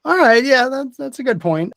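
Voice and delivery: very high character voice, conceding; Regina. Acted.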